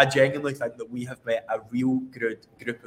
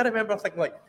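normal talking voice

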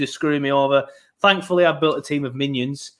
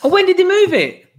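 surprised outburst; 14 semitones higher, 6 dB louder than usual. Real, not acted. Finds out the match moved.

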